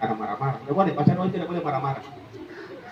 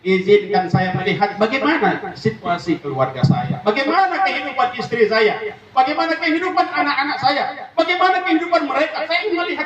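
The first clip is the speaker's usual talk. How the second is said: pleading corpse; 7 semitones higher than usual. Acted. The dead man pleads with Allah to see his family. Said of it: "Kata jenazah kepada Allah".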